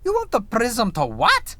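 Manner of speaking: high, drawn-up disbelief; incredulous questioner. Acted. Reviewer imagines writers' meeting, incredulous. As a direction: act out a baffled colleague. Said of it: "I imagine the conversation was something like".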